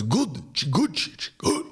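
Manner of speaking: high, halting cartoon dad; hammed-up phone reply; mate's dad. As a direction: answer in a squeaky put-on old voice. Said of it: "And he goes".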